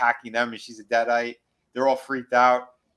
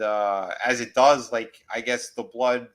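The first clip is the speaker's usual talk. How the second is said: usual speaking voice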